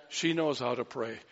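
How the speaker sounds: usual speaking voice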